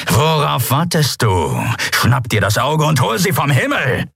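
impatient captain driving on his crew; angry command. A narrator's reading. Angry captain orders underling to attack.

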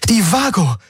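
high cry of sudden realization; excited, high-pitched exclamation; exclaimed. A narrator's reading. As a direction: burst out with the idea. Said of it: "rief Kirai aus".

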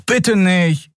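high, imploring little plea; plaintive and high, falling away; pleading. A narrator's reading. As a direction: beg for mercy. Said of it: "flehte Miko".

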